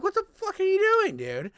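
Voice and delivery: high, loud, baffled what the fuck; mock exasperated yell at dad; incredulous outburst. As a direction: shout it at him in disbelief.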